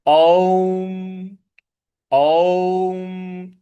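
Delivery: ओम held long and resonant; chanted ओम demo; drawn-out Om. Shown as a demonstration. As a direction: intone ओम slowly, letting it ring. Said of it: "इस प्रकार से इसको बोला जाएगा".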